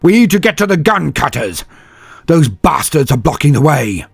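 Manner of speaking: high, loud, driving call; urgent shout. A narrator's reading. Vitko shouts their goal over the fighting, urgent and loud. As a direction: shout it over the battle noise.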